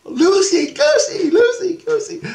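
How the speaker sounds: put-on very high squealing children's chant; piercingly high mimicked kid voices; imitated kids. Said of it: "And the kids go".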